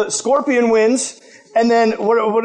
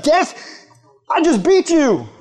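taunting; 7 semitones higher than usual. Pretend. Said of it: "He's rubbing it in their faces".

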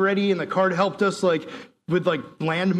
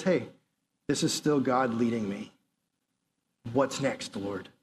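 calm trusting prayer; 6 semitones lower, 6 dB quieter than usual. Pretend. Paul's calm trusting reply.

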